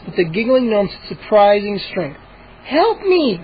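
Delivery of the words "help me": high plea for help; calling for help. Acted. Sister calling back to the boys for help. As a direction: call back while being dragged. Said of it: "Mindy called back".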